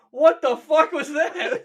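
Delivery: high, loud, drawn-out disbelief; mock alarmed outburst. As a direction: burst out in exaggerated shock. Imagined victim's startled reaction.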